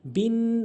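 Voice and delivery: বিন nasalised and held; nasal ghunnah. Shown as a demonstration. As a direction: hum the n of বিন. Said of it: "গুন্না হচ্ছে নাকের ভিতরে এরকম নিয়ে আওয়াজ করা".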